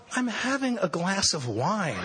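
high, slow and laughing; mock-innocent reply, laughing; laughing innocence. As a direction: play the innocent with a chuckle. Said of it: "And I said".

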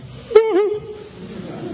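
long, high mock-tearful gasp; overwhelmed gasp. Hearing the boss let him off, high overwhelmed gasp. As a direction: draw in a high, whimpering breath. Told this was enough.

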